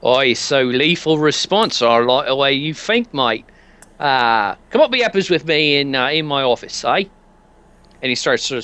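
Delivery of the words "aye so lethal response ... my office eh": light British shopkeeper voice; British accent. Acted. Sammy Fraser inviting the customer upstairs in a British accent. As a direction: play Sammy as a chummy Brit. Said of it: "can't do this accent very well".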